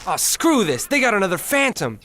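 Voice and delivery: high-pitched, exasperated outburst; frustrated griping. Acted. Soldier griping to his squad as a Phantom appears, frustrated. Soldier complains, told to shut up.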